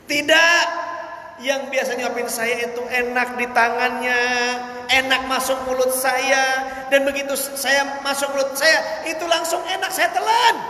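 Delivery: a pleading beggar, pitched high and rising to a shout; beggar's voice. Acted. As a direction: raise the voice high and gush over the food. Beggar praising the one feeding him.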